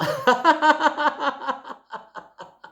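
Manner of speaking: squealing high giggle; high laughter. Shown as a demonstration. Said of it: "simplement riez". (rire aigu devant le miroir)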